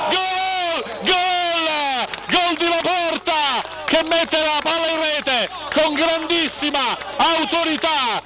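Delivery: high slow shouted goal; excited goal call. Real, not acted. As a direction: stretch out the goal cry. Goal call in live commentary.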